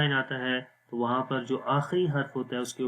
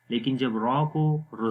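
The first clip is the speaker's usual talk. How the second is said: ordinary delivery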